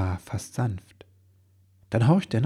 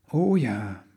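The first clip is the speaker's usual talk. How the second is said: plain reading voice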